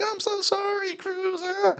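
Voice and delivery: very high, soft mock-meek mother voice; meek mom. Meek mom apologising to kid.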